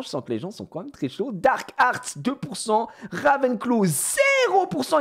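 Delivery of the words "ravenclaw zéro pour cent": high, slow, loud disbelief; mock outrage. Real, not acted. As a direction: act scandalised at the zero. (indignation exagérée, aiguë et lente)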